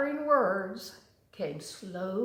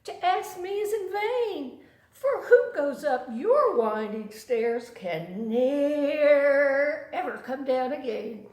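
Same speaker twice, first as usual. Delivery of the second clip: a small fly, higher and laughing, slowing down; 4 semitones higher, 7 dB louder than usual. A narrator's reading. Fly telling the spider his stairs are a trap, high and laughing. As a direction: giggle it out as the tiny fly. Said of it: "said the little fly".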